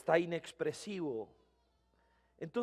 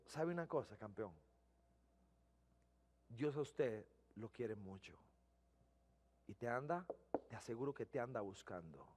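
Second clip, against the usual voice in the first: much softer, lower, gentle voice; tender reassurance; 8 semitones lower, 13 dB quieter than usual. Retold or imitated. Pastor telling the tearful driver God seeks him, soft and tender. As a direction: say it gently as a promise.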